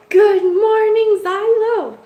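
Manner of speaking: high, loud, slow pet-talk voice; sing-song greeting for a beloved pet. Real, not acted. Cooing good morning to her cat.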